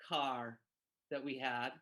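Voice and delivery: ordinary talk, nothing marked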